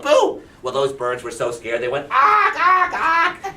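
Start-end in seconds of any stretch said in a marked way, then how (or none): 0.0-0.4 high loud boo; playful shout; yell boo. Acted. Frogs yell to scare. 2.1-3.5 high, loud panicked bird cries; shrieking ack ack; scared bird squawks. Acted.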